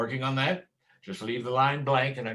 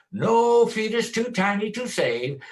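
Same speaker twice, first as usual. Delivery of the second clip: raised, hollering mock zealot; mocking zealot quote; 8 semitones higher, 5 dB louder than usual. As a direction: parody a loud zealot slogan. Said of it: "holler about pro-life".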